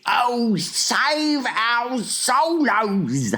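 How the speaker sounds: high, long-drawn wail; hammed-up giant crying for rescue; anguished cry. A narrator's reading. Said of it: "he cried".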